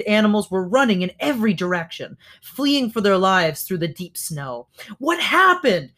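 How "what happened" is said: high, alarmed shout; urgent raised-voice question. A narrator's reading. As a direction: call it out in alarm over the chaos. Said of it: "shouted Roz".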